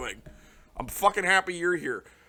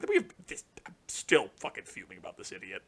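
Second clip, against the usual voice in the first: ordinary delivery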